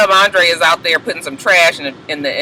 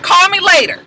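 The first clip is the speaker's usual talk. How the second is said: calling after him; 4 dB louder than usual. Retold or imitated. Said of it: "she was just like".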